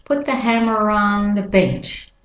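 American vowels, "on" said like "ahn"; American-accent model sentence. Shown as a demonstration. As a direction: link "hammer on" with an American R. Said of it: "And the American accent".

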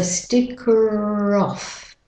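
"sticker off" slowly joined by a stretched R; British-Australian linked "sticker off"; linking R. Shown as a demonstration. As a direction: draw out the R between "sticker" and "off". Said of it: "link the er to the O".

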